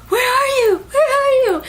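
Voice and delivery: very high, wailing mock cry; frantic calling. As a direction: act out a desperate search call. Retold frantic search for dying friend.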